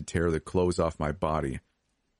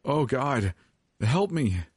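high, desperate plea; anguished pitched-up appeal; weeping; 8 semitones higher, slower than usual. A narrator's reading. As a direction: cry for help, pitch soaring. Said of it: "I wept".

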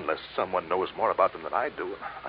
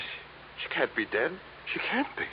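anguished disbelief; 8 semitones higher, 6 dB quieter than usual. Acted. Disbelief at girlfriend's death.

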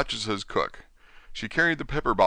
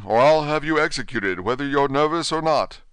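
louder, higher angry threat; 7 semitones higher, 5 dB louder than usual. Acted. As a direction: threaten him like an irate king. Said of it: "the King repeated angrily".

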